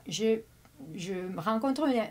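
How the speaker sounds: usual speaking voice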